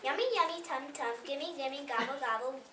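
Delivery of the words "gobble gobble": normal talking voice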